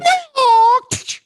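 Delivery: loud high karate cry with punch noise; shrieked mock fight sound effect; karate movie punch. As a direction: imitate a kung fu film strike. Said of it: "those sound effects when they punch somebody".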